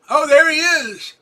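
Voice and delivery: high, loud delighted welcome; light up as a friend arrives; big excited hello to a regular viewer; excited greeting. Real, not acted.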